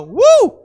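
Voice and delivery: high, loud crowd cheer woo. Shown as a demonstration. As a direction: belt out a party woo. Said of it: "you're gonna go".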